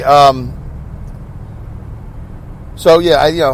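ordinary delivery

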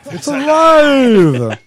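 high, drawn-out mock-dramatic cry; film mad-scientist shout. Co-host hams up a horror film line, mock-dramatic shout. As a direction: stretch it out in a loud, high howl.

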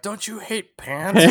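high, slow goofy cartoon voice; Homer impression. Retelling a cartoon scene, slow high goofy voice. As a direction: drawl it out like a dopey cartoon dad. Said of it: "Homer's like".

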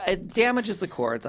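ordinary talk, nothing marked

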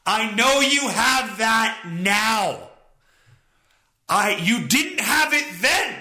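losing patience on the phone, repeating himself; frustrated. Retold or imitated. Caller to the agent on the phone, exasperated and losing patience. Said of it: "you can tell I'm getting frustrated".

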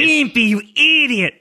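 high-pitched cartoon scolding; Ren and Stimpy character. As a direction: pitch it way up like an exasperated cartoon sidekick. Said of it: "like a Ren and Stimpy character".

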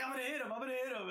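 high, fast excited chatter; racing driver chasing a Lego pig, quick and eager; eager rush. Retold or imitated. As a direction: rattle it off while chasing. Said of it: "I'm like".

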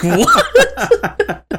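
high, laughing, stunned cry; stunned what. Retold or imitated. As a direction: blurt it out in shocked laughter. Stunned reaction to mom.

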